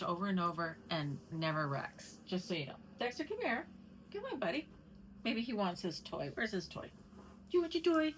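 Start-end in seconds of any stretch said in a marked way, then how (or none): 3.0-4.7 pet talk. Real, not acted. Calling the dog. 6.9-8.1 pet talk. Real, not acted. Coaxing the dog.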